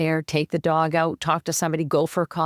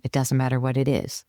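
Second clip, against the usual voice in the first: ordinary delivery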